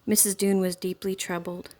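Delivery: usual speaking voice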